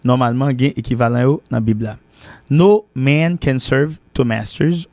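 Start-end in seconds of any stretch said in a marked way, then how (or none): 2.5-4.9 slow model reading. Shown as a demonstration. Teacher repeating the proverb for students, slow and deliberate. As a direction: spell out the proverb for learners. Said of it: "On ne peut pas servir deux maîtres à la fois".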